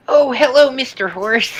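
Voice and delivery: high polite little engine voice; Percy voice. Retold or imitated. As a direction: greet the horse sweetly. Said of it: "he just goes".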